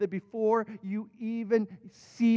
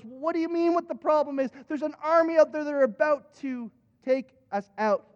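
acted-out alarm; 6 semitones higher than usual. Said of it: "goes into a junior high screaming fit".

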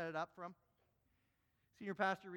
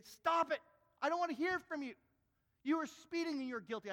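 high, loud angry bark; furious officer; 9 semitones higher, 7 dB louder than usual. Acted. Officer shouting down the prisoner in his cell, furious. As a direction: shut him down with fury.

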